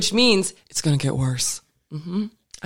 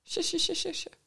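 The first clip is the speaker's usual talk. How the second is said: high, laughing sarcastic agreement; 14 semitones higher, slower than usual. Real, not acted. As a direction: giggle through the sures.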